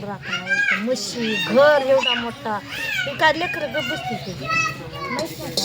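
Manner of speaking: loud, high-pitched objection; exasperated, voice shooting up; heated protest. Real, not acted. Protesting who will mind the house.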